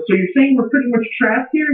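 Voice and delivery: high-pitched, mildly frightened question; retold fright. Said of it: "she's all frightened and stuff".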